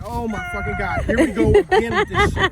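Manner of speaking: high, loud, exasperated cry of disbelief; exasperated panic. Retold or imitated. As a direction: squeal it out like "not this again". Fleeing attackers, exasperated outburst.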